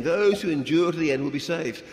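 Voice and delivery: 1 emphatic scripture quote. Retold or imitated. Quoting Jesus to the congregation, loud and emphatic. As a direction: proclaim the verse with force. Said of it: "Jesus said the same thing".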